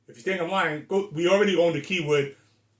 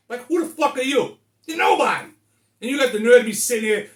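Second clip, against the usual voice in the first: higher, louder, heated retort; reliving telling off the bus drivers; indignant outburst; 8 semitones higher, 7 dB louder than usual. Retold or imitated. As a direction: fire it back at the bus drivers. Angry retelling of his retort.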